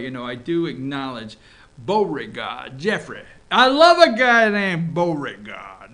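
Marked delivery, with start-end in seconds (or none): 3.5-5.9 loud, slow gushing over the name. Acted. Host savoring a senator's name with loud mock delight.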